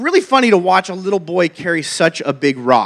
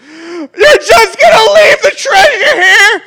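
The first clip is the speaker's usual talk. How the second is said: very high, loud, slow wailing, half laughing; kids falling apart; 7 dB louder, slower than usual. Retold or imitated. Kids protesting dad dropping the treasure, high hammed-up wail. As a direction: wail it like a heartbroken kid, hammed up. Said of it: "They lose it".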